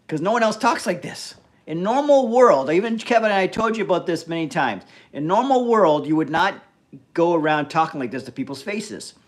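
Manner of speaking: emphatic, fed-up lecture; exasperated scolding. Real, not acted. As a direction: lay down the law to a badly behaved chatter. Scolding a chatter for bad behaviour.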